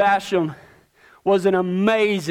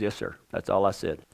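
much softer and lower, timid; meek yes sir; 12 semitones lower, 8 dB quieter than usual. Retold or imitated. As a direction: answer meekly like a scared boy. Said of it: "I was scared to death".

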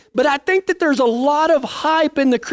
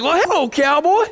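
sing-song teasing hello, higher; higher mock-cheery greeting; 6 semitones higher than usual. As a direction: rib an old friend playfully. Teasing friend on phone call.

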